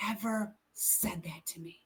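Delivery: woman moved by the pastor's words, whispering; awed whisper. Retold or imitated. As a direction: hush it out in disbelief.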